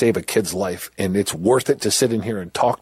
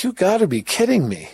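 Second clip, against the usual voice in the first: much higher, slowing on the question; you can't believe what you're hearing; incredulous; 12 semitones higher than usual. Retold or imitated. Prisoner facing the class, high and incredulous. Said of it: "And I'm like".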